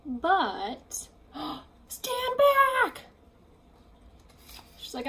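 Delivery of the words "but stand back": a gasp, then stretched slow warning; dramatic stand back. Acted. Piggy warning Gerald before her big throw, dramatic.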